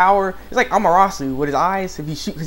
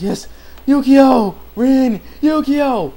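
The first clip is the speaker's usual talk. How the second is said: loud, high, drawn-out name calls; mock calling out; 10 semitones higher, 7 dB louder, much slower than usual. Brothers calling each other, hammed-up shouts. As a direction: stretch each name in a dramatic imitation.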